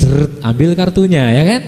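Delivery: normal talking voice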